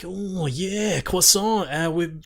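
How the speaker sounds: high, lightly mocking French presenter; a light imitation of French developers; mock French dudes. Mock French developer voice. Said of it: "they were like".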